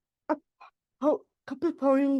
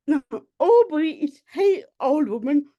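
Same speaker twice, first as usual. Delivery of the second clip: ordinary talk, nothing marked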